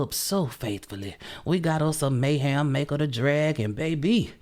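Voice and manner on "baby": ordinary talk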